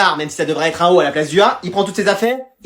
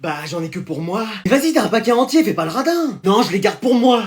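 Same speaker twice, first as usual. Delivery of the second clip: usual speaking voice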